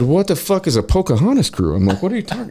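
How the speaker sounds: high-pitched incredulous question; baffled disbelief. Retold or imitated. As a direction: demand an explanation, totally bewildered. Baffled reaction to boss's order.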